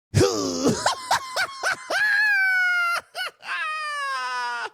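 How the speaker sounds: long, high wail of laughter; cracking up so hard it sounds like crying; shrieking laugh. Real, not acted. Viewer watching the stabbing scene wails with laughter. Rewatching the moment that got him.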